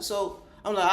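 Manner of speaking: normal talking voice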